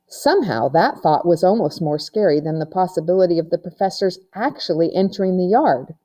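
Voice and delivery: usual speaking voice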